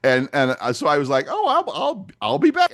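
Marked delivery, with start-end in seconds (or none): 1.3-2.7 much higher, bright eager promise; excited and friendly. Retold or imitated. Man to checkout girl, promising to come back, excited. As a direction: chirp it back like a happy regular. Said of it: "really excited and happy and friendly".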